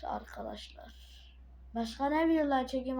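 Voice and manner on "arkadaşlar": whispered call. Real, not acted. Whispering to the viewers. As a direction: breathe the word out softly.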